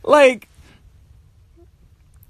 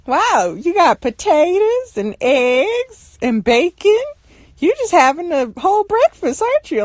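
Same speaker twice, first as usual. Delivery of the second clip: mock admiring, pitched up; imitating sister; 6 semitones higher than usual. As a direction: mimic a sister hinting for food. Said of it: "she's like".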